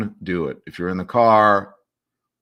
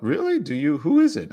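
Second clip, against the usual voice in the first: mock surprise; 7 semitones higher than usual. Host teases a commenter's gossip.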